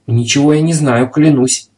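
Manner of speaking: usual speaking voice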